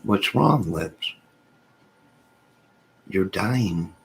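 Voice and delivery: ordinary delivery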